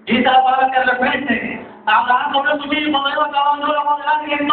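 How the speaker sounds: high, driving preacher's delivery; impassioned sermon storytelling; full-force preaching. Real, not acted.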